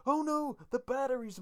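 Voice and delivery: very high, loud hammed-up alarm; mock panic. Co-host asking friend for their own batteries moment, high mock-panicked cry. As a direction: wail it in exaggerated cartoon panic. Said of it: "they managed to panic".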